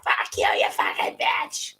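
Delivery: slow mock drunk snarl, almost whispered; drunk lady cursing. Quoting a drunk guest cursing at the actor, slow hissed snarl. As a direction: play the rude drunk, hissing it out slowly. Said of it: "drunk lady flipping the bird".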